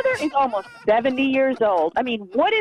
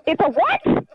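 surprised; 5 semitones higher than usual. Real, not acted. Learns it was a prank.

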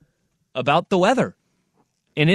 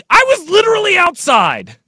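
exasperated; 12 semitones higher, 5 dB louder than usual. Retold or imitated. Arguing with wife about rain.

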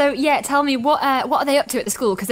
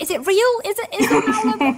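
surprised; 10 semitones higher than usual. Retold or imitated. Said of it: "I was like".

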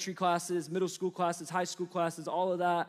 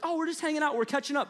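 mock innocent chatter; 8 semitones higher, faster than usual. Mocking gossiping friends.

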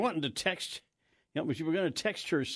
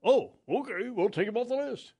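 high, loud chirpy caller voice; mock telemarketer; 10 semitones higher, 4 dB louder than usual. Imitating telemarketer reply.

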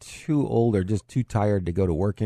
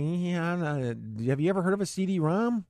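mock sceptical; 8 semitones higher than usual. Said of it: "I'm like".

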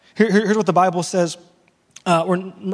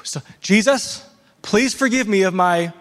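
mock panic; slower than usual. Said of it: "in a panic".

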